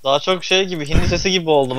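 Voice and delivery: usual speaking voice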